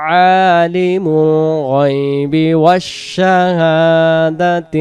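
slow, drawn-out melodic recitation; Quran recitation. Acted. Teacher reciting the verse slowly for students to repeat. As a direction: chant each phrase slowly, stretching the vowels.

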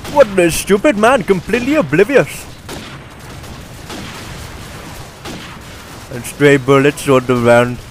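put-on Indian-accented game commentary voice. Gamer narrating his gameplay clips, in an Indian accent. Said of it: "this is my Indian voice".

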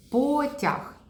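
Потяг said slowly and clearly, falling at the end; clear demo word. Shown as a demonstration. Speech therapist showing the word to a child, clearly. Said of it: "суть така".

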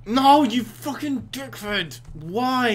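high, loud, drawn-out cursing; angry wail. Real, not acted. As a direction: wail it in raging frustration. Curses game after failing.

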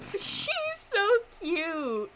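high, slow, loud gush; laugh, then melt over how adorable she is; gushing coo. Real, not acted. Gamer doting on a little game character, gushing coo.

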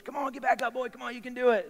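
high-pitched coach cheering a player on; high school coach. Retold or imitated. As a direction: egg them on like a school coach. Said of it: "you know, this coach".

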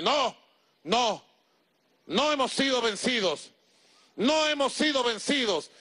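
high, insistent shaman's denial; defiant shaman. A narrator's reading. Shaman telling the warrior they are not beaten, defiant. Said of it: "shaman defiantly contradicts the warrior".

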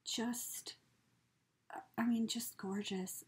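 soft awed whisper. Real, not acted. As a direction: whisper it, lost for words. Awed at a gift.

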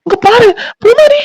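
very high, stammering cries; panicked alarm. Acted. Man sees her sari on fire, panicking. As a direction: call out in panic, drawing words out.

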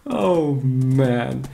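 normal talking voice

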